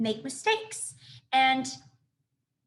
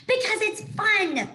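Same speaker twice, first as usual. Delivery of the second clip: gleeful opossum; 9 semitones higher, 5 dB louder, slower than usual. Acted. Opossum gleefully explains acting.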